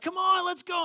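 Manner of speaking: high, loud eager call; excited kids. Retold or imitated. Kids rushing their parents into the cookie tent, eager. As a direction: kids bursting to run in.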